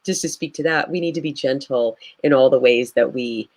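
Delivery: usual speaking voice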